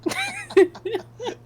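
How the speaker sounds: loud, high burst of laughter; delighted laughter. Real, not acted. Co-host cracking up at the mock advert, loud high laugh. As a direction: crack up loudly.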